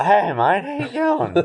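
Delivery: imitating Bobby. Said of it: "Don't pretend to be me".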